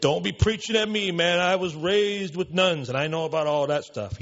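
high, loud, pushy New York tough guy; loud New York accent. Retold or imitated. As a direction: hammer it in a New York accent, slowing on the nuns. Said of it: "this guy had that kind of an accent".

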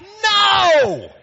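very high, loud, shouted protest; comic squawk of refusal; hammed-up shout; Lazarus refusing.

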